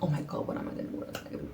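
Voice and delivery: whispered, frantic; panicked whisper. Retold or imitated. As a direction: hush your panic under your breath. Hiding half naked, panicking.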